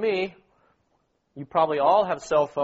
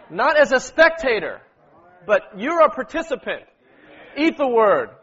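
exhorting; 5 semitones higher, 6 dB louder than usual. Real, not acted. Exhorting listeners to take part.

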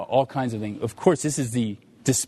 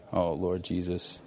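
ordinary delivery

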